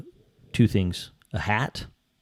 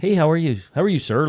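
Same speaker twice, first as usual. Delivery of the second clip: put-on chipper polite college kid; 7 semitones higher than usual. A man mimics kids greeting him in the elevator, high and eager. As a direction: greet like an overly nice student. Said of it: "they're always like".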